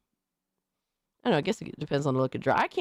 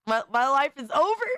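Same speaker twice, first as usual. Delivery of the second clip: mock despair; 7 semitones higher than usual. Streamer mourns his ruined image, hammed-up wail. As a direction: ham up the tragedy on the repeat.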